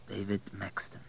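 very soft murmur to himself; barely audible private murmur; murmured under breath. A narrator's reading. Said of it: "Abel murmured under his breath".

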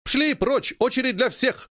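high, forceful chant-like retort; roared reply. A narrator's reading. Seamstresses turn away queue-jumpers, roaring in answer. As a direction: roar it as a drilled crew. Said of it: "ревут девушки".

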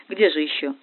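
usual speaking voice

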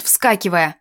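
usual speaking voice

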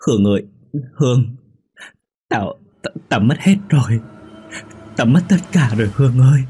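a friend crying down the phone, sobbing and begging; sobbing plea. Acted. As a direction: plead through tears to her friend. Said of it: "Quyên khóc lóc sụt sùi, mếu máo để nói".

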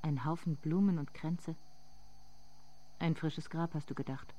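soft, intimate narrating voice; softly spoken inner monologue; hushed story narration. A narrator's reading.

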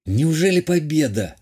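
mildly disbelieving question, no real whisper; can hardly believe it, understated; incredulous. A narrator's reading. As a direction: voice a touch of disbelief. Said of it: "недоверчиво прошептал Вайсак".

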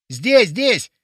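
ordinary delivery

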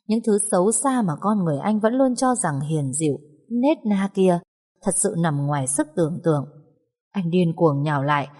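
plain reading voice

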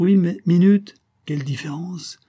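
high exclamation, loud at first; lifted, emphatic enthusiasm; excited objection. A narrator's reading. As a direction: jump in with an eager correction. Husband objects, enthusing over necklace.

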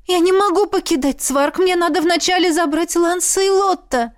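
high, pressing plea; urgent protest. Acted. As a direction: insist desperately on going back. Heroine insists on fetching children.

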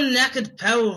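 badly faked Scottish accent; attempted Scottish accent. Shown as a demonstration. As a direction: have an unsure go at a Scottish accent. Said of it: "try and do a Scottish accent".

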